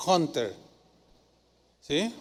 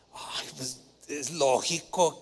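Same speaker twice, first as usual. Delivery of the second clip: much lower, slow, sneering voice; deep mock disdain of a doubter; scornful skeptic; 5 semitones lower, 7 dB quieter, slower than usual. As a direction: drag it out like a know-it-all mocking the miracle. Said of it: "los que explican todo dirían".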